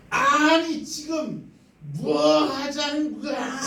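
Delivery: high, slow furious shout. Acted. Naaman insulted by the servant, furious high shout. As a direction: blow up at the insult. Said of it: "화를 내는 거예요".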